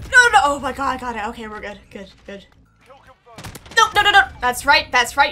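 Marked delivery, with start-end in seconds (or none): 3.8-4.2 loud, high, rapid-fire refusal; panicked shout. Real, not acted. Player in a fight, panicked shout.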